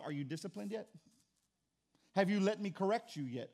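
usual speaking voice

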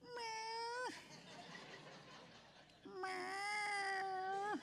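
high, soft pleading meow; coaxing meow. Shown as a demonstration. Pastor coaxing the stray out of the garage, high soft meow. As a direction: call the cat sweetly. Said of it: "I'm like".

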